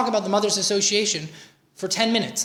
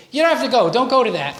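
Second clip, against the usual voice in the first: higher and louder, pressing her not to go; insistent advice; 5 semitones higher, 6 dB louder than usual. Retold or imitated. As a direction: push it like a dismissive husband. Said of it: "normally I would say".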